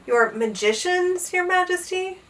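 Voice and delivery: hesitant, slow question rising high; shivering apprehension. A narrator's reading. Page boy fearing the king's magicians, apprehensive. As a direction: ask with a touch of dread. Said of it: "Bartholomew shivered".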